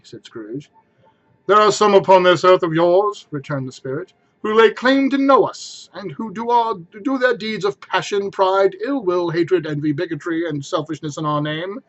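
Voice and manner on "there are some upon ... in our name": stern spirit. Acted. Spirit rebukes Scrooge, grave and commanding. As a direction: lay down the judgment weightily.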